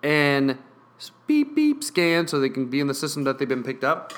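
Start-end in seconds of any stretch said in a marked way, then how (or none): 1.3-1.8 high electronic beep beep imitated by mouth; scanner beep. Retelling the store checkout, mimicking the scanner beep.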